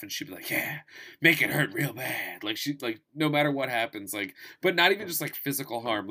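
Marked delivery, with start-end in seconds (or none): 0.5-2.4 hammed-up lustful voice; mock-aroused villainess; turned-on henchwoman. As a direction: play aroused by the threat, over the top. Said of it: "gets horny on badness".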